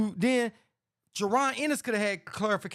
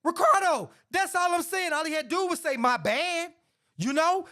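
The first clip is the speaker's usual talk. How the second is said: exasperated outburst; 8 semitones higher than usual. Real, not acted.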